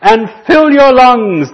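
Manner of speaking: high, loud, expansive call; earth crying out. Retold or imitated. The earth answering the fearful man, loud high cry. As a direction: boom it out like the earth itself. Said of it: "But the earth cries".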